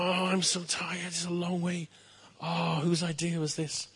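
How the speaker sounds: a hint of a whiny, tired servant boy; Eddie Izzard. Tired page lagging behind the king, lightly whining. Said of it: "I went into a bit of, uh, Eddie Izzard".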